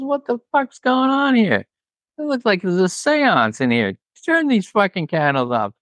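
imitating Bob. Dylan storming in at the candles, mock outburst. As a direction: rant like an annoyed old rocker.

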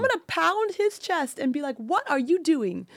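high, loud railing at God; impassioned, grief-stricken protest; anguished angry rant. Retold or imitated. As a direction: cry out in outrage, stretching the accusing words. Acted rant at God over husband's death.

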